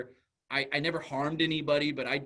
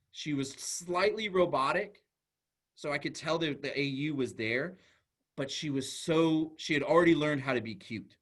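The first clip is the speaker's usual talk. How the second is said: ordinary talk, nothing marked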